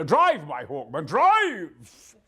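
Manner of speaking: slow, booming command, rising high; hammed-up, drawn-out roar; heroic battle cry. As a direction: bellow it like a war leader. Corrected mock battle cry.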